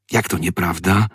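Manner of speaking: ordinary delivery, nothing marked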